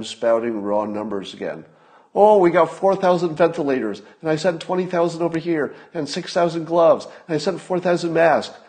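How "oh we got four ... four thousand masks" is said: mocking the briefing. Mocking the task force briefing.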